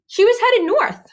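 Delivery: high, loud and eager; excited. A narrator's reading. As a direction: announce it with excitement. Duff points the way.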